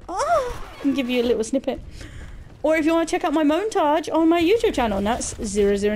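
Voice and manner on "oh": fake moan. Shown as a demonstration. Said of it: "I can just go".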